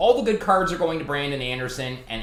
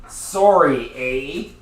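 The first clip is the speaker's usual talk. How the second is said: Canadian sorry eh; 9 dB louder, much slower than usual. Acted. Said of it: "Nothing more Canadian than on Canada Day saying".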